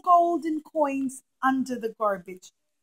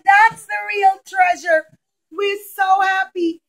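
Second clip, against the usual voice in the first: joyful; 7 semitones higher, 11 dB louder, slower than usual. Acted. People rejoice at the kotel.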